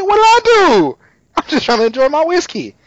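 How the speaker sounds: high-pitched mock protest, loud at the start; protesting innocence. Retelling a drinker who claims innocence, high mock protest.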